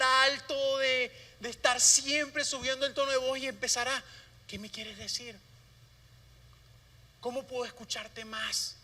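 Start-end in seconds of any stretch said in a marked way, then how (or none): 4.5-8.7 much softer, leaning in to hear a whisper; hushed listening. Shown as a demonstration. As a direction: pastor showing how to lean in to hear God, soft and hushed. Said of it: "para un susurro tienes que como hacer así".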